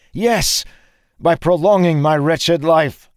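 very high, loud cry; impassioned, high-pitched protest; anguished outburst. A narrator's reading. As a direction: burst out in bitter despair. Anguished outburst mid-fight.

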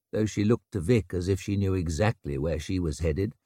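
plain reading voice

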